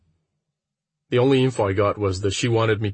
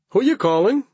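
high, loud teasing question; teasing friend voice; 13 semitones higher, 4 dB louder than usual. Acted. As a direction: tease him as you barge in.

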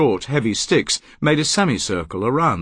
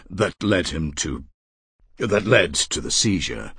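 halting "that led him to", pause, then restarted; brief hesitation; 4 semitones lower than usual. Acted. Erasmus explaining his father's seizure to Walpert, hesitating briefly. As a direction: break off after "led him to", then reword it. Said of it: "the brief hesitation".

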